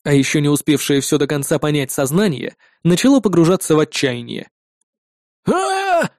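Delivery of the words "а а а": high pitched battle yell; high roaring yell. Acted. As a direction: let out a desperate roar. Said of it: "взревел было ещё один".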